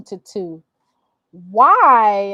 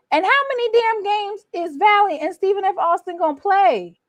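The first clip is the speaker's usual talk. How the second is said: higher, incredulous rant; exasperated; 10 semitones higher than usual. Real, not acted. Vlogger fed up with endless Valley games, exasperated. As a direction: throw up your hands at the schedule.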